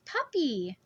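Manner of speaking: put-on high, bright child's squeal of delight; squealing little girl. A narrator's reading. Little girl squeals at the puppy in delight. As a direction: squeal it like a thrilled small child. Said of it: "She stretches out both hands and squeals".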